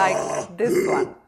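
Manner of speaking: slow, rough sound from deep in the throat; guttural demo. Shown as a demonstration. Teacher demonstrating a guttural sound to her students, slow and throaty. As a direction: growl it out from the gut like a gutter drain. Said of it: "Guttural sounds come deep from the throat".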